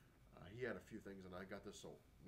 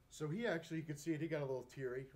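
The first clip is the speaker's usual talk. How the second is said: higher, louder, welling up with emotion; a little teary; 6 semitones higher, 9 dB louder than usual. Real, not acted. Recalling his idol's reaction to the promise, teary. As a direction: push on through real tears. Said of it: "as I'm doing now".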